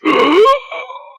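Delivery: sharp high gasp for air. Pretend. Running out of air gag.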